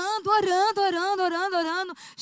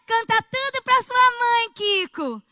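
high, loud and slower scolding mother voice; Dona Florinda impression; 6 semitones higher, 4 dB louder, slower than usual. Retelling the Chaves scene, slow high scolding mother. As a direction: stretch it out like a sitcom mom nagging her son. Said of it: "aí tem aquela história".

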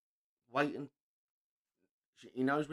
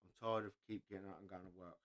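weary reading; 7 semitones lower, 11 dB quieter than usual. Retold or imitated. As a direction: let each line sink with exhaustion. Reading out a sad meme.

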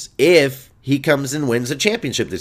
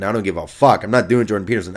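normal talking voice

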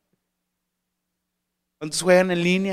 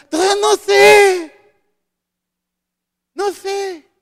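put-on much higher, loud whiny teenager; clueless teen voice; 13 semitones higher, 12 dB louder than usual. Teen student answering the adult with a high whiny voice. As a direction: toss it off like a lost student.

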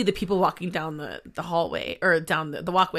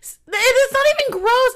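excited; 8 dB louder than usual. Real, not acted. Defending her odd habit.

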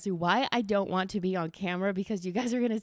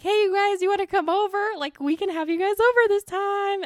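much higher and louder, bubbling over; excited invite; 12 semitones higher, 6 dB louder than usual. Retold or imitated. Inviting friends Jess and Anthony over, excited high call. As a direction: squeal the invitation like you can't wait. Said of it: "I'm like".

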